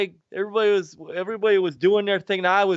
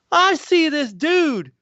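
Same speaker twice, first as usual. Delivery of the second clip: higher, louder and slow with wonder; excited; 12 semitones higher, 4 dB louder, slower than usual. Real, not acted. Man telling his friend about his first Sega game, awed and excited. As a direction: relive the awe, drawn out.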